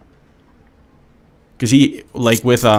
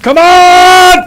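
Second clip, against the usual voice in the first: even higher, louder all-out war cry; loud gung-ho soldier; 13 dB louder than usual. Acted. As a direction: scream it at the top of your lungs. Hyped gung-ho battle cry.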